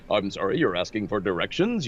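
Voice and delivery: absurd radio-ad voice for small talk; old broadcast announcer. Acted. A stranger on the street giving directions, in announcer voice. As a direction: swoop up and down like a pitchman. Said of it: "Why are you talking like that".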